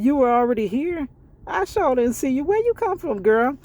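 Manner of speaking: high mocking question; sarcastic disbelief. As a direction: pitch climbing high, mock-amazed. Sarcastic disbelief at line cutter.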